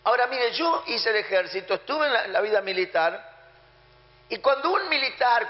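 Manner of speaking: ordinary talk, nothing marked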